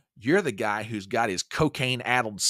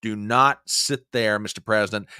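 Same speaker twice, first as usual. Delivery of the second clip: ordinary delivery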